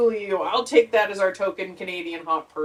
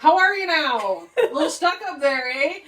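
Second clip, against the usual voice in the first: folksy Canadian greeting; 6 semitones higher than usual. Retold or imitated. Gosling as stereotypical Canadian.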